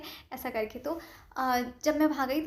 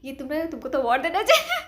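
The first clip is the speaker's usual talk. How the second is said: laughing praise; 2 semitones higher, 6 dB louder than usual. Retold or imitated. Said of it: "तो sir बोलते हैं".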